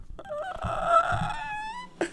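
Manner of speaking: long, very high-pitched fake crying squeal; hammed-up whimper drawn out thin and high; mock sob. Frustrated player fakes a breakdown, high mock sobbing. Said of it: "about to cry myself".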